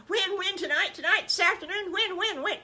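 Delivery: put-on high, loud little-kid clamour; squeaky eager piglet voice; impatient piglets. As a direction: pester excitedly with rapid questions. Piglets clamour to know when.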